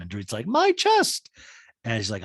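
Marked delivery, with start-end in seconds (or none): 0.5-1.3 very high, slow put-on voice; Dorit impression. Dorit praising PK in dual talking head, high put-on voice. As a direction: coo it in a squeaky voice.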